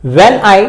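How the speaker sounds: "when I" as one metrical foot, weight on I; stress on second word. Shown as a demonstration. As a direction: lean a little on I in "when I". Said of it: "इस पे थोड़ा stress देके बोला जा रहा है".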